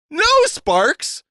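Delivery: high, loud near-shout; almost shouting. A narrator's reading. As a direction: cry out to stop him. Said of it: "she almost shouted".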